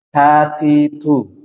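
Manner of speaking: "tatitu" drawn out slowly; slow syllable drill. Shown as a demonstration. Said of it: "secara sifat". ("tatitu" pelan per suku kata)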